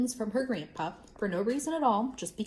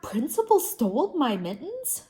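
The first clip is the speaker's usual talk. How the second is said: shocked; 5 semitones higher, 4 dB louder than usual. A narrator's reading. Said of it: "very shocked".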